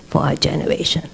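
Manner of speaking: whispered, voice dropped to almost nothing; hushed whisper. Retold or imitated. Rebbetzin whispering to the bandaged soldier, hushed. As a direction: breathe it out reverently.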